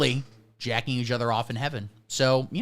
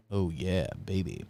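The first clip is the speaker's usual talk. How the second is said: smug; 8 semitones lower, 9 dB quieter than usual. Acted. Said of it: "smug reply".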